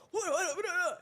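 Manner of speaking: high-pitched cartoonish babble; mock excited gabbling. Imagines herself gabbling at friend on stream.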